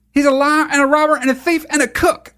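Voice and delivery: high-pitched mock Southern preacher; mocking Greg Locke. Host parroting the clip back, mocking preacher. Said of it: "How do people still talk like that?".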